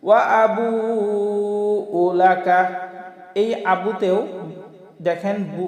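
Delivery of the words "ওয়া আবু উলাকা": আবু stretched four beats; long vowel. Shown as a demonstration. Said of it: "চার আলিফ টান হবে".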